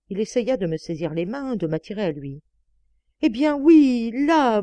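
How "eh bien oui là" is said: loud, high, flustered admission; worked-up, blurted confession; spluttering. A narrator's reading. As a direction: splutter it out in agitation. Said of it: "bredouilla-t-il".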